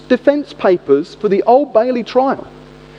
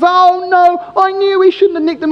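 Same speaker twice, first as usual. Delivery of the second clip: guilty accomplice; 8 semitones higher than usual. Retold or imitated. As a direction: groan it like the accomplice seeing it all go wrong. Accomplice caught, retold.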